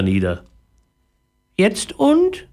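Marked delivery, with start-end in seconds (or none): 1.6-2.5 very high squawky parrot talk. Acted. Parrot answering the boy in a high squawky voice. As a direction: chirp it out like a little parrot. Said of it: "fragte er".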